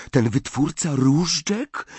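a timid, worried question; frightened question. A narrator's reading. Ginny asking about the missing wandmaker, frightened. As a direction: ask it in a small scared voice. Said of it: "zapytała ze strachem Ginny".

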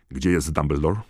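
curt and demanding; sharp question. A narrator's reading. Knot storming into the ward, sharp and demanding. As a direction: bark the question on entering. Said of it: "zapytał ostro Knot".

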